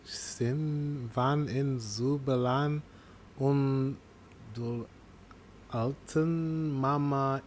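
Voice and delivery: slow, soft, unsure sounding-out of the dialect; halting reading attempt. Real, not acted. Reacting host trying to read Walser German aloud, haltingly. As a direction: feel your way through unfamiliar words quietly. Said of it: "Trying to read whatever type of German that is".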